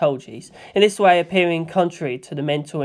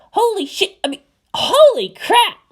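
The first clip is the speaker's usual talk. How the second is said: put-on very high, loud kid's yell; excited yell; 4 dB louder than usual. A narrator's reading. Schoolboy spots Shinji, high excited yell. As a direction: blurt it out in shock. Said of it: "one of the primary schoolers yelled".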